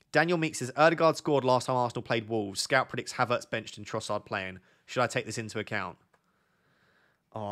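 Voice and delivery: ordinary talk, nothing marked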